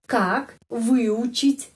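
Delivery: как выучить drawn out very slowly, syllable by syllable; slow pronunciation demo. Shown as a demonstration. Teacher slowly pronouncing a Russian phrase for learners. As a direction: model как выучить at half speed. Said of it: "Di sini gampang".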